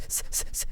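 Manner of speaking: voiceless hiss like mic fuzz; imitated static hiss. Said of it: "I just hear s".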